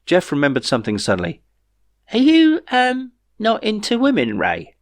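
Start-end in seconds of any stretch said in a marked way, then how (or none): 2.1-4.8 much higher, hesitant and awkward; awkward question. A narrator's reading. Jeff awkwardly asks Ray if he likes women, high-pitched. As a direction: ask it squeakily, embarrassed.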